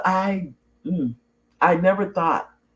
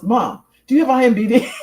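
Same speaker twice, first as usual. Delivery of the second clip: higher, louder repeated question through laughter; laughing retelling; 9 semitones higher, 6 dB louder than usual. Retold or imitated. Mom retelling her son's repeated question, laughing. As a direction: imitate his insistent asking while cracking up. Retells son asking again, cracking up.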